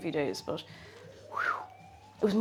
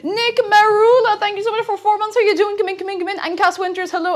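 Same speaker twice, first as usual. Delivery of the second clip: much higher, loud cheery greeting; excited welcome; 12 semitones higher, 8 dB louder than usual. Real, not acted. Welcoming a four-month subscriber, excited.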